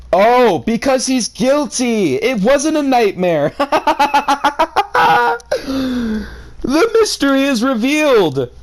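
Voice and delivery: high, hammy gotcha reveal with a laugh; mock triumphant exclaim. Real, not acted. Reader gloating over the story's twist, high mock triumph. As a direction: crow it like you cracked the case.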